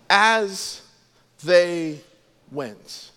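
"As ... they ... went" with a pause after each word; word-by-word emphasis. Shown as a demonstration. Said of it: "see these three words".

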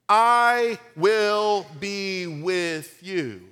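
very slow, drawn-out promise; solemn divine reply. Acted. Preacher voices the Lord reassuring Gideon, slow and solemn. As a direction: let each word land slowly and gently. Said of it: "The Lord responded".

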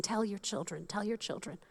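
soft repetition. Shown as a demonstration. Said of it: "I love the emphasis it keeps saying".

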